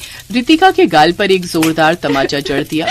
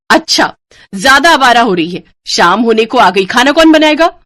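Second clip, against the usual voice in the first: loud, higher scolding voice; angry scolding; 4 semitones higher, 6 dB louder than usual. Acted. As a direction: scold her sharply. Sister-in-law scolds girl. (ऊँची आवाज़ में डाँटते हुए)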